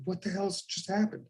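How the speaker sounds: stunned pondering. Retold or imitated. Actor going home after surprise praise, hushed bafflement. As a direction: quietly puzzle it out in disbelief. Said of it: "I'm pondering now this encounter".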